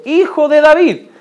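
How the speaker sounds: loud, high-pitched call; crying out. Acted. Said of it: "él clamaba mucho más, diciendo". (clamando con fuerza, en voz alta)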